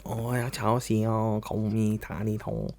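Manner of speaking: slow fake Chinese as the dog; mock Chinese. Dog answering in slow, soft mock Chinese. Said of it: "he would be like".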